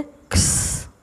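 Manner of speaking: क्स as a voiceless k-s cluster, no vowel; sound of x. Shown as a demonstration. Teacher demonstrating the sound of letter x. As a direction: hiss out क्स, just k and s. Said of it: "एक्स की sound क्या है?".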